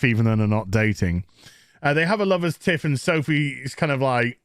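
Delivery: ordinary talk, nothing marked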